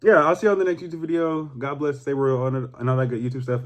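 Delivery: ordinary talk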